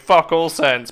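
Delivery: ordinary delivery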